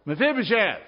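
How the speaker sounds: loud, high call through the door; calling out a name. Acted. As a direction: shout his name like a soldier at the door.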